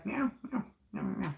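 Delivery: high, soft meowing; cat voice. Pretend. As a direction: meow gently like a kitten. Saying goodbye to cat Dashi.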